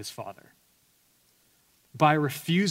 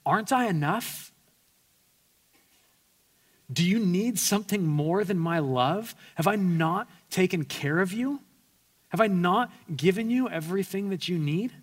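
higher, pained questions to his son; hurt pleading father; 5 semitones higher than usual. Acted. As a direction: let the father's heartbreak ask. Father's hurt plea voiced.

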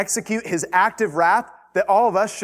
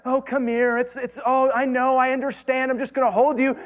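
mock coddling voice; 8 semitones higher than usual. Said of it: "books that are just like".

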